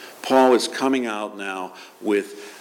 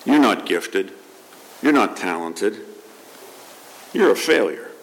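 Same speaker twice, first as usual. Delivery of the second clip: voice of condemnation; 3 semitones lower than usual. Retold or imitated. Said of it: "The voice of condemnation".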